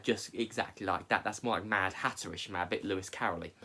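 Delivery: ordinary talk, nothing marked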